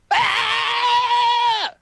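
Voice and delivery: high imitated scream; lobster scream. Imitating the lobster's scream, high-pitched shriek. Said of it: "and it screams".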